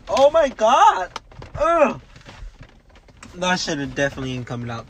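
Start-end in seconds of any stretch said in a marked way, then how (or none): 0.1-2.0 high, slow exasperated oh my God; frustrated groan. Real, not acted. Fighting the stuck backpack, a high exasperated groan.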